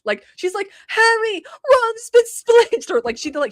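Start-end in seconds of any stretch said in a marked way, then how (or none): 0.9-2.9 high, loud, slow mock posh British girl; drawn-out alarmed schoolgirl, imitating; hammed-up British witch voice, much higher; Emma Watson impression. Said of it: "she sounded exactly like Emma Watson".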